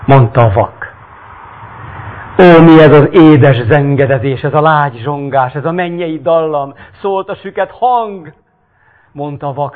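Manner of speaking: dramatic reading of a Bible-like tale; story narration. A narrator's reading. Reads the story aloud.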